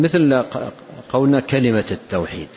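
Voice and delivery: ordinary delivery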